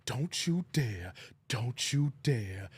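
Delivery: low, slow warning growl; a hint of a stern preacher's threat; lightly voiced Doctor Umar. Streamer slipping into his Doctor Umar impression, low slow warning. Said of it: "I used to do a good Umar".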